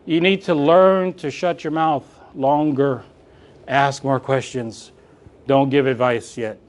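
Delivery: slow, firm advice; slow coaching emphasis. Shown as a demonstration. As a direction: lean on each word like a coach. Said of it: "coaching advice".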